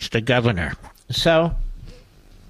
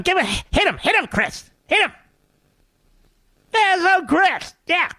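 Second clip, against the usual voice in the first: a higher, pushy TV host needling his guest; Scarborough impression; 5 semitones higher than usual. Mocking Scarborough pressing Christie to attack Trump, higher hammed-up host voice. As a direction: egg on the guest like a cable host. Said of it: "from doing Scarborough".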